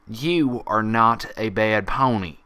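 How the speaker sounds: loud, deliberately slow; slow firm insistence. Acted. Applejack leading Pinkie, slow and loud. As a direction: press each word home.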